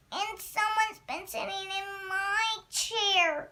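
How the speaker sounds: put-on high, loud Baby Bear cry; wee small voice. A narrator's reading. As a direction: wail it like an indignant little bear. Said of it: "cried Baby Bear in his wee small voice".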